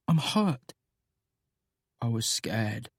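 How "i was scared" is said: soft hushed confession; hushed admission of fear; whisper. A narrator's reading. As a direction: whisper it into the dark. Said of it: "Nate whispers".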